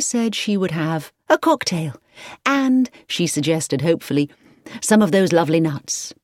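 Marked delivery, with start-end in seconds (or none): none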